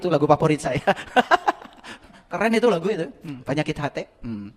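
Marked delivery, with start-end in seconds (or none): 0.9-1.9 breathy high burst of laughter. Real, not acted. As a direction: laugh at your own joke. Laughs again. (tawa kecil)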